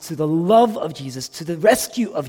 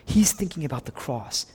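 usual speaking voice

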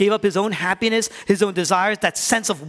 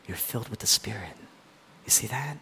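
whispered, breathy hush; an awed whisper about the Spirit; 8 semitones lower, 10 dB quieter than usual. Real, not acted. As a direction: drop to a whisper to let it land. Preacher drops to intimate hush.

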